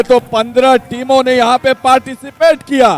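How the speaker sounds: announcer hyping the night; dramatic promo voice. Acted. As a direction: build suspense like a trailer. Dramatic promo intro over music.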